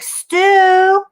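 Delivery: high sharp call of a name; calling like Helen. Retold or imitated. Wife summoning husband for hair dye, high loud call. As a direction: yell his name up high. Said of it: "So she's like".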